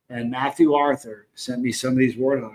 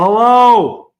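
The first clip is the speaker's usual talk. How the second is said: loud drawn-out hello; 7 semitones higher, 12 dB louder than usual. Real, not acted. Greeting chat viewers.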